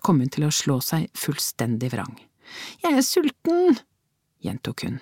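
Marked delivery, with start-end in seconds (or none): 2.8-3.8 put-on much higher, stubborn child's whine; child whining with more defiance; defiant whine. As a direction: dig in and whine harder. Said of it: "med enda mer trass".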